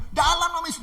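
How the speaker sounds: high, loud invocation; bold declaration. Acted. Pastor invoking Jesus' name against robber, bold. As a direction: ring it out with authority.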